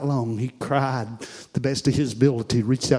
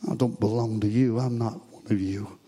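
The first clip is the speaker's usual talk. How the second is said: low, soft repeat after the outburst; acted denial, sinking softer to the end; hushed retold denial; 4 semitones lower, 6 dB quieter than usual. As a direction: drop right down and say it quietly. Same denial repeated after scream.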